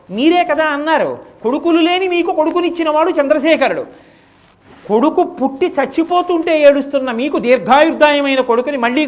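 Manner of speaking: put-on a boy urging his crying father with conviction; earnest boy pleading. Son pleading with weeping father.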